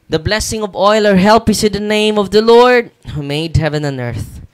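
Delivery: loud, ringing liturgical recitation; formal exorcist's prayer, projected loudly; prayer of blessing. Real, not acted. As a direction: proclaim the blessing forcefully over the oil.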